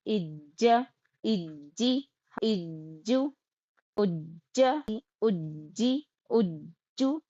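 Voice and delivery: recitation drill. Real, not acted. Student recites the syllable drill to the teacher, low and careful.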